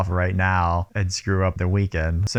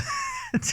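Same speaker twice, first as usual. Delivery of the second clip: very high, soft squeal of a laugh; high squeaking laugh; squeaky laugh; 8 dB quieter than usual. Real, not acted. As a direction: let out a tiny shocked squeak. Reacting to a gruesome threat.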